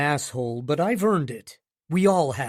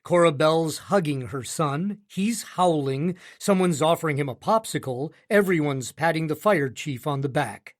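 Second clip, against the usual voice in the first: plain reading voice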